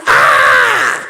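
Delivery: deep, loud shouted ah; mock altar cry. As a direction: bellow the altar's scream.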